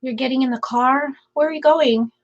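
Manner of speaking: hoarse, scratchy voice. Real, not acted. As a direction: voice going hoarse, starting to lose it. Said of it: "my voice is weird right now".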